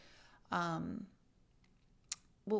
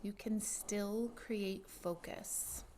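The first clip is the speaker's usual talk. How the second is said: guided meditation; 8 dB quieter than usual. Acted.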